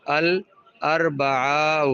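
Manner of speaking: الاربعاء stretched out slowly, syllable by syllable; slow model pronunciation. Shown as a demonstration. Teacher modelling the Arabic word الاربعاء, slow and careful. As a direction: draw out الاربعاء so students can copy it. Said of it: "بدھ کو کیا بولا؟".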